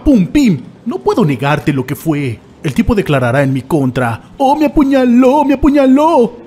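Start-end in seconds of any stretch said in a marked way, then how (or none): 4.4-6.4 higher, whiny mock complaint; mocking whine. Mocking the man testifying, in a whiny voice. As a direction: mimic the snitch.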